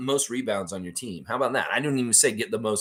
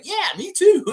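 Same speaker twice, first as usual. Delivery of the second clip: much higher and louder squeaky voice; imitating Jack; 8 dB louder than usual. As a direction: chirp it back in falsetto. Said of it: "And Jack's like".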